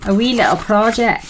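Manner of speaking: put-on unplanned Scottish accent while crafting. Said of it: "talking with a Scottish accent".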